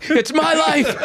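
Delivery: high, fast, laughing plea; hammed-up desperate comic. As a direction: beg to stay on stage, pitched high. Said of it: "they light me and I go".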